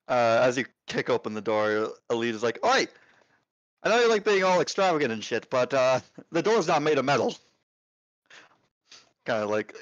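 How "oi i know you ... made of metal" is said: raised-pitch barkeep's scolding; a bit annoyed. Acted. Barkeep to entertainer who kicked the door, a bit annoyed. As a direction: chide a showy regular, voice pitched up. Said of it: "a bit annoyed".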